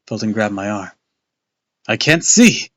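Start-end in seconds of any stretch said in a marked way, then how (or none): 1.8-2.7 high, loud panicked shout. A narrator's reading. Luke shouts blind in the dark barn. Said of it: "Luke shouted".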